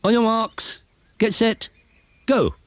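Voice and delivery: high, called start countdown; starter's shout. Acted. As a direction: call the runners off the line. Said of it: "shouted Broomstick".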